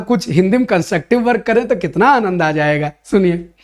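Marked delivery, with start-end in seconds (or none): none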